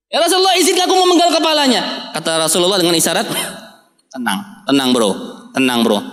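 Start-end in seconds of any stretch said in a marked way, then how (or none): 0.1-2.1 fierce plea. Acted. Umar marah cabut pedang. 4.1-6.0 calming. Acted. Rasul menenangkan Umar.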